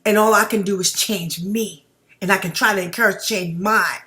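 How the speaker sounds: usual speaking voice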